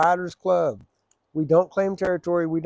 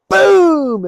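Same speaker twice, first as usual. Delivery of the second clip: shouted boom, much higher and louder; loud crash sound; 14 semitones higher, 14 dB louder than usual. Retold or imitated. Biker acting out falling off his bike, loud crash boom. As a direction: bark out the crash like an impact.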